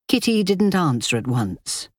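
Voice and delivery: ordinary delivery, nothing marked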